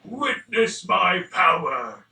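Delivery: slow, booming villain proclamation; boss rage phase. Acted. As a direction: roar it like a final boss. Dark lord unleashes power.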